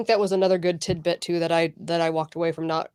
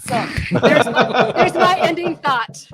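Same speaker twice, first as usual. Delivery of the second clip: high, loud, laughing through the words; 7 semitones higher, 6 dB louder, slower than usual. Real, not acted. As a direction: wrap up while cracking up. Laughing after her own joke.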